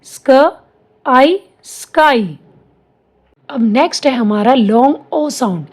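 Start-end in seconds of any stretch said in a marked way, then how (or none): 0.1-2.4 slow phonics blend ending on स्काई; sounding out. Shown as a demonstration. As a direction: say स्क, आई one sound at a time, then blend them slowly into स्काई. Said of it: "एसके को स्क पढ़ेंगे".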